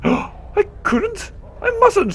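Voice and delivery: high, breathless gasping refusal; shocked gasp. Acted. Nenny horrified at being asked to hit, high gasping. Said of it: "looking shocked".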